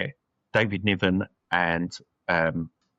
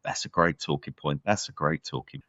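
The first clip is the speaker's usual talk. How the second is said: lower, softer mental-note voice; musing to himself; 5 semitones lower, 5 dB quieter than usual. Retold or imitated. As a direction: voice a private thought under the main talk.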